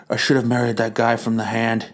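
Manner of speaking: low, soft put-on wife voice; a weary wife handling everything. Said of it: "his wife looks at the watch".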